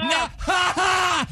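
high taunting ha ha; mocking laugh. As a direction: cackle at the doubters. Host mocking the coworkers.

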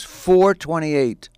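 slow, drawn-out exact time; precise retold answer. Friend setting an oddly exact dinner time, slow and precise. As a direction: stretch out each number.

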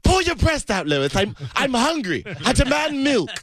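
high cartoon voice. Impressionist demanding milk in a high cartoon voice. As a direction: whine high like a hungry cartoon.

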